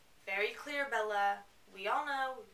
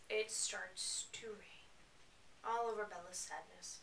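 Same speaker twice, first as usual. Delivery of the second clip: whispered aside; 2 semitones lower, 8 dB quieter than usual. Real, not acted. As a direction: whisper it for drama.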